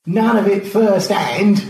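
high, loud mocking call; loud, high-pitched heckle. A narrator's reading. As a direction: holler the jibe from another room. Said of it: "George called".

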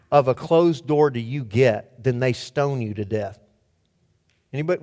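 usual speaking voice

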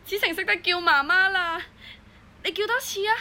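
tender, rising amazement; overjoyed disbelief. Acted. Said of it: "嗰一刻我真係開心到乜都唔記得晒".

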